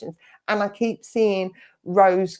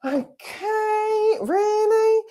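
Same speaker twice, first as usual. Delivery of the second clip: high, incredulous "really?"; exasperated disbelief; 14 semitones higher, much slower than usual. Retold or imitated. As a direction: act fed up with childish colleagues. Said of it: "But you're just like".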